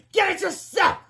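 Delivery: high sharp shout; shouting back. Acted. Secretary shouting back at the principal. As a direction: fire it back. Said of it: "shouted back".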